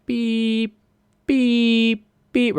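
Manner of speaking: high mock car horn beeps; playful honking noises. Imitating car horn honks.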